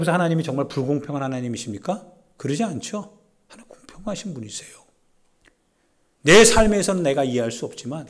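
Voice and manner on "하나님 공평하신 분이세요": hushed breathy whisper. Real, not acted. Preacher whispers the answer to the congregation. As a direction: drop to a secret whisper. Quiet aside after rhetorical question.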